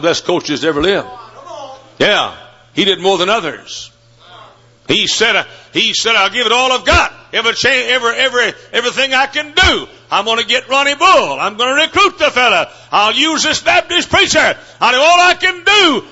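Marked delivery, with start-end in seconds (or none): 6.2-16.0 rising fervent vow; fired-up coach. Retold or imitated. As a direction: build the coach's vow to a fever.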